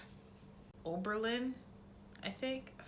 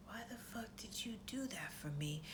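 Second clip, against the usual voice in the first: very soft, near-whispered imitation; hushed question; 3 semitones higher, 11 dB quieter than usual. Peter privately questioning Sansa, hushed question. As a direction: ask it under your breath.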